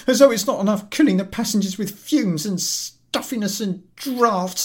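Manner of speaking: high, loud indignant rant; ranting invalid. Acted. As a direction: berate the collector. Invalid keeps complaining.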